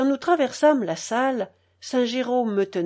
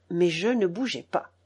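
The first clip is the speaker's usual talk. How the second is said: plain reading voice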